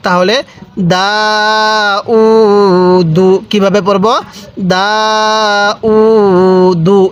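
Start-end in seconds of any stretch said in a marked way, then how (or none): 0.9-3.3 long "দা", long "উ", short "দু"; one-alif stretch. Shown as a demonstration. Teacher demonstrating to learners, "দা" and "উ" held long. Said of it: "এক আলিফ টান দিব". 4.7-7.0 long "দা", long "উ", short "দু"; one-alif stretch. Shown as a demonstration. Teacher demonstrating to learners, "দা" and "উ" held long. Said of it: "এরকমভাবে শিখব".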